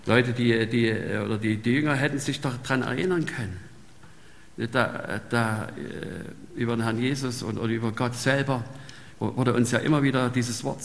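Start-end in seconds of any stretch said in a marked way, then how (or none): none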